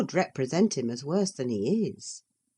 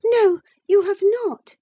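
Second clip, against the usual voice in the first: high, louder, near crying; tearful protest; 8 dB louder than usual. Acted. Infatuated Isabella defying Catherine, tearful and high. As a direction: blurt it through tears. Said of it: "said the infatuated girl".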